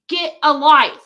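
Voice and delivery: loud, angry jab at someone; exasperated yell. Real, not acted. Telling off an obsessed stalker, angry and loud. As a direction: snap it out loudly, fed up.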